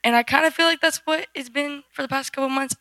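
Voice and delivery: ordinary delivery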